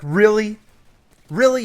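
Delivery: sharp, high, loud disbelief; really can't believe what she just said; loud, high double take; incredulous. Retold or imitated. Disbelief at the girl's claim.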